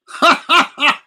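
high loud bark of a laugh; growling laugh. Acted. Dracula laughing savagely at her fear for the poet. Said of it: "His laugh was like an animal growl".

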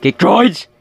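loud excited call; loud exclamation. Real, not acted. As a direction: blurt it out loudly. Exclaiming in game.

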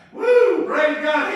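high, loud joyful whoop; loud, high woo of praise; ecstatic whoop. Real, not acted. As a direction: burst out in holy joy. Ecstatic outburst in sermon.

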